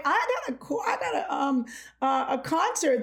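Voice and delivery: high-pitched, laughing self-imitation; mock protest. Younger self defending practice to siblings, mock protest. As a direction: plead your case in a high mock protest. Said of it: "I'm like".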